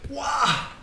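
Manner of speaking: normal talking voice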